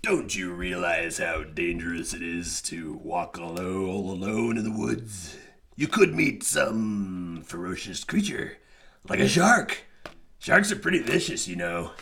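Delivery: a lightly deepened sly wolf; low, smooth big bad wolf talk; deep wolf voice. A narrator's reading. Wolf charming the little girl, lightly deep voice. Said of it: "in his deep voice".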